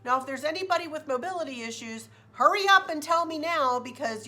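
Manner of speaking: lightly mimicked, emphatic announcement; intercom announcer. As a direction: call out instructions to a crowd. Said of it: "came over the intercom and said".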